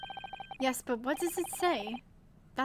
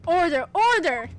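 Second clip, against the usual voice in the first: louder, commanding gavel call; judge calling order; 3 semitones higher, 7 dB louder than usual. Acted. Judge to the rowdy court, a loud commanding call. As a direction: silence the courtroom with it.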